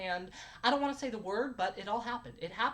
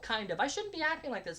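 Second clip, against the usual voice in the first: ordinary talk, nothing marked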